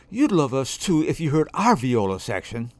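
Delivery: plain reading voice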